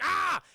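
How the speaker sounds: short, high, loud "ah"; sudden yell imitating a film character; acted scream. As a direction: burst out with a mock scream. Possessed man screams in retold scene.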